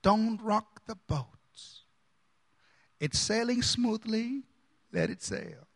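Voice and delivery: ordinary delivery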